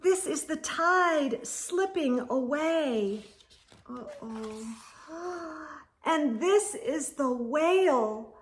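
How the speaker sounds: ordinary delivery, nothing marked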